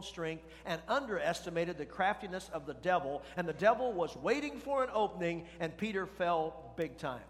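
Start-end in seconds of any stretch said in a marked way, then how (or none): none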